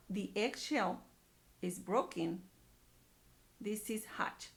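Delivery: plain reading voice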